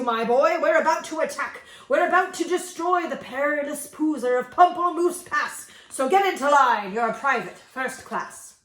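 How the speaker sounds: loud hammed-up military commander; bellowing general. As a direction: bellow orders like a blustering general. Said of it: "He bellowed".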